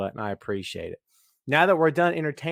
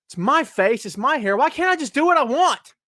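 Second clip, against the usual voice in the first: mock indignant; 6 semitones higher, 5 dB louder than usual. Defiant rant about his hair.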